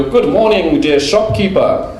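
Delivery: mock the learner's over-polite greeting; a foreign learner greeting the shopkeeper too formally; overly formal customer. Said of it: "And you go".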